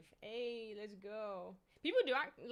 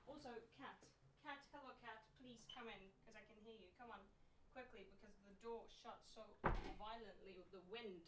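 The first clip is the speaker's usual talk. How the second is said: soft murmur to the cat; hushed, coaxing the cat inside; quiet; 2 semitones lower, 12 dB quieter than usual. Real, not acted.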